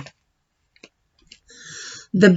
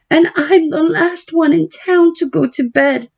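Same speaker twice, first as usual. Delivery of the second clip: put-on higher girl's voice, pleading; a daughter begging papa for help; young Babylona pleading; 10 semitones higher than usual. Babylona protests to father.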